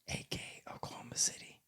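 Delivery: breathy whisper of the full name; whispered aside. Real, not acted. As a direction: drop to a secret whisper. Whispered aside naming city.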